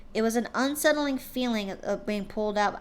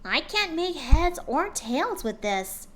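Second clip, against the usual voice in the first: slow, groaning frustrated pony voice; Twilight, groaning; 6 semitones higher, slower than usual. A narrator's reading. Reader voicing Twilight stuck on a puzzle, groaning. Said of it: "Twilight groaned".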